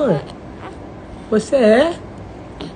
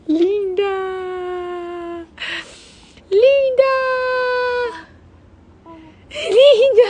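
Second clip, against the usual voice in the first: excited exclaim; 7 semitones higher than usual. Real, not acted. Father exclaims to his baby.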